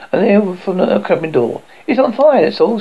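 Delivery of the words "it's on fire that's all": ordinary delivery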